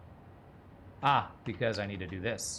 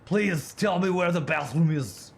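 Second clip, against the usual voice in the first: slow, loud mock Austrian accent; Arnold Schwarzenegger; 7 semitones higher, 6 dB louder than usual. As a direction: plead as a hammy Austrian tourist, high and drawn out. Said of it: "I don't do a great Arnold impression".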